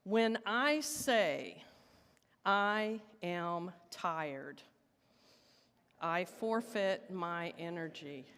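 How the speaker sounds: slow, measured leading line for the room to echo; slow call and response. Shown as a demonstration. Leading the congregation in call and response, slow and measured. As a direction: draw each word out so the room can answer. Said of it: "do this as a call and response".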